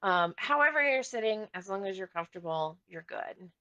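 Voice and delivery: normal talking voice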